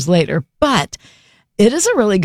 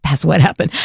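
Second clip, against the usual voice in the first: normal talking voice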